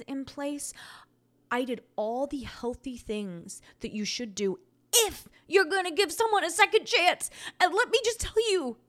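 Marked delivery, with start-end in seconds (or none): 4.9-8.8 emphatic warning. Real, not acted. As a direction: drive the advice home. Warning listeners about men.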